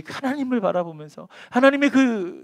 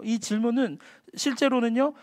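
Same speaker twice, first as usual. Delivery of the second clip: normal talking voice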